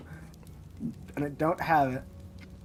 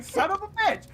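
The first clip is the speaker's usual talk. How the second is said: high-pitched frustrated curse; exasperated curse; 14 semitones higher than usual. Retold or imitated. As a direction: spit it out in disbelief. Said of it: "I'm like".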